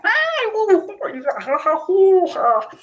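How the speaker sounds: high, loud goofy hoots and ha-has; imitating silly family sound effects; silly noises. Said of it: "different voices, different sounds".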